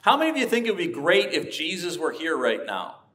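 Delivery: ordinary talk, nothing marked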